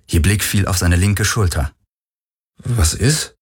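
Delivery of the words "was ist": higher, rising puzzled question; mildly thrown, ask what's wrong; irritated, puzzled. A narrator's reading. He notices her staring at his shoulder, mildly irritated. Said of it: "fragte er irritiert".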